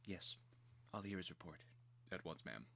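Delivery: soft whispered reply; captain and general whispering orders quietly. Acted. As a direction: hush it like a private aside.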